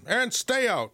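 a proud guard dog shooing the delivery man off; playing the dog. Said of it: "and the dog says".

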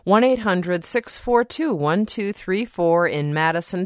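ordinary delivery

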